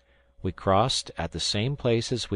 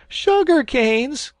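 "Sugar canes!" much higher, louder, slow and stretched; excited cry; 8 dB louder, much slower than usual. A narrator's reading. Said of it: "cried they all".

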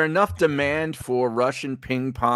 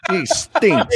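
mock Russian host; 8 semitones higher than usual. Joking Russian TV bit.

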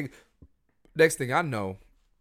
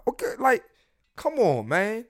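exasperated; 11 semitones higher, 3 dB louder than usual. Real, not acted. Exasperated complaint.

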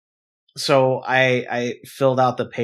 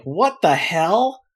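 drawn-out, higher disbelief; shocked what the hell; 7 semitones higher, 4 dB louder, much slower than usual. Retold or imitated. At the bank teller, slow shocked disbelief. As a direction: stretch it out in stunned outrage.

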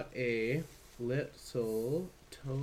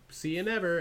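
lilting, higher goodbye; breezy brush-off; 7 semitones higher, 4 dB louder than usual. Retold or imitated. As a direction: toss it off lightly and leave. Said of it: "sometimes you're like".

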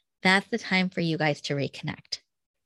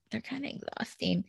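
whispered aside, unvoiced; 4 semitones higher, 11 dB quieter than usual. Real, not acted. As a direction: breathe the words out as a secret. Tired parent aside whispered.

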